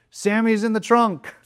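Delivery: high bright call that Sammy's in the trunk; excited reveal. Retold or imitated. Girls calling out the hidden friend, excited. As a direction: announce the surprise with glee.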